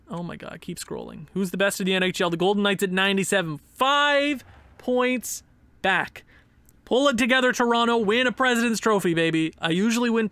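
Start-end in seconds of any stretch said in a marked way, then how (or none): none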